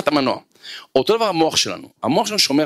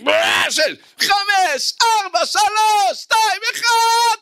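very high, drawn-out shouted reps; straining countdown shout; much slower than usual. Acted. Trainee pushing through final reps, screamed countdown. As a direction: scream each count as if forcing out the last reps. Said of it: "והחלטתי שצועק עליי".

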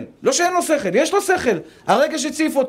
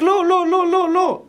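reason shouting no; 8 semitones higher, slower than usual. Retold or imitated. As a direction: cry out the no like an alarm. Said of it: "השכל צועק".

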